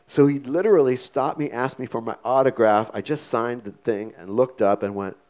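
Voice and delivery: usual speaking voice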